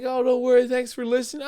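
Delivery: high sweet imitation; her mock thanks. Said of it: "she's like".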